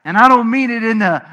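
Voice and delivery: usual speaking voice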